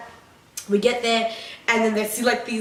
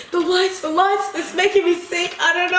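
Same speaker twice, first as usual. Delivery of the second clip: mock complaint; 7 semitones higher than usual. Acting out sick, complaining girl.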